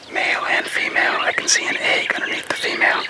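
hushed whisper near the owl box. Real, not acted. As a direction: whisper so the owls stay calm. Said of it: "we approach the box quietly".

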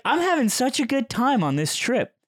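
high-pitched mock delight; retold gushing. Friend telling him about the trip, mock gushing.

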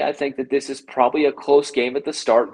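ordinary talk, nothing marked

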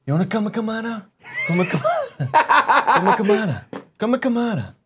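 high-pitched mumbled taunt; Steven Seagal. Acted. Doing a requested action-star impression, high and slurred. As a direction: run the words together, pitched high. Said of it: "Give me some Seagal".

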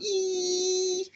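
high piercing alarm squeal; imitated alarm. Reading a comic aloud, mimicking the alarm with a high squeal. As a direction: squeal it out like a shrill alarm going off. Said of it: "an alarm in the background".

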